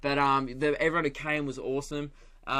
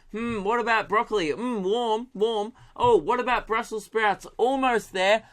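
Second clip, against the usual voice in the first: lightly voiced, higher playful guessing; a hint of a teasing hot-and-cold game; guessing game voices; 6 semitones higher than usual. Friend and farmer playing hot and cold over vegetables, higher and playful.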